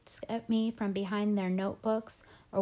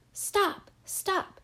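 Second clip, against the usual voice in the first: high, loud cry of alarm; sudden high alarmed shout; 12 semitones higher, 3 dB louder than usual. A narrator's reading. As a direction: shout it as Thorin in a panic. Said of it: "shouted Thorin".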